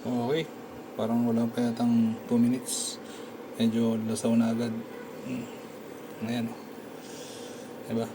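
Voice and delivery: ordinary talk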